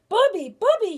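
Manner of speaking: loud, high, alarmed call; crying out. Acted. Frightened grandfather running home to his wife, crying out. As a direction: call out urgently for help. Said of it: "he cried".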